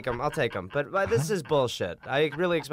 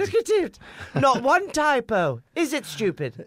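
high, loud, slow proud mother; mock proud mom; 11 semitones higher, 4 dB louder than usual. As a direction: gush over the book like mom. Continues mocking mother.

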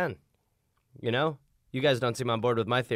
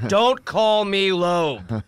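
slow, louder mock indignation; mock offended; 5 semitones higher, 5 dB louder, much slower than usual. [name] scolding a partner about his name, mock offended. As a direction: drag out the protest like a wounded ham.

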